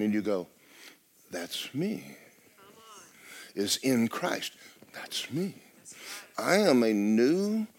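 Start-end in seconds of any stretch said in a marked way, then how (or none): 1.3-2.2 quiet claim. Acted. Answering the verse as oneself. 4.9-5.5 whispered, higher, much softer. Acted. Repeating the claim, hushed.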